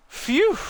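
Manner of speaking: high, loud sigh of relief; relieved phew. Acted. Student finds a safe spot and sighs with relief. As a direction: blow out in relief.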